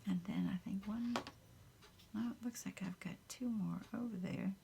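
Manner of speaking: soft unvoiced whisper close to the mic; ASMR whisper. Crafter whispering to her viewers while working, soft whisper. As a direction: whisper it gently, as if not to wake anyone.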